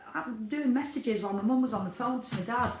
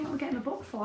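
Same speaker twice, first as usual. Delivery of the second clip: usual speaking voice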